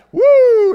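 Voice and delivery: loud high "Woo!" cheer; joyful whoop. Real, not acted. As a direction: whoop it to fire up the room. Preacher cheers for the crowd.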